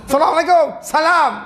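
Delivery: high, shouted salam; rough greeting. Shown as a demonstration. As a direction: shout the greeting harshly, demonstrating rudeness. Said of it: "kau ni kasar". (salam kasar, menjerit)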